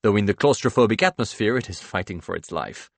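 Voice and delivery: ordinary delivery, nothing marked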